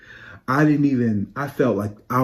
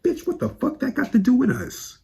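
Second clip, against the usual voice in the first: much higher, incredulous fake rant; sassy high mock outburst; 13 semitones higher than usual. Fan reacting to a post, high outraged squeal. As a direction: squeal it like you can't believe the nerve. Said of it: "I was like".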